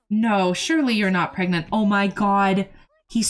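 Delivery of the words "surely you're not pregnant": ordinary delivery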